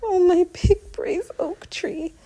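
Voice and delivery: high whispered mother through tears; a brave laugh through crying; mother's forced fond whisper; forced tender laugh. A narrator's reading. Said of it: "forces a laugh".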